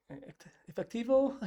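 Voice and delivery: "efectivo" rising very high like an unsure question; hesitate, then lift "efectivo" into a high question; questioning statement. Retold or imitated. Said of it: "every statement was a question".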